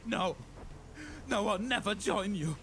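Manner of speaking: high-pitched, defiant refusal; desperate cry. A narrator's reading. Guardsman refusing to join the plague marine, desperate cry. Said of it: "Constantine cried".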